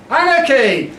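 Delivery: high, ringing protest call; rallying cry. Acted. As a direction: call it out to a crowd. Rallying cry in performed poem.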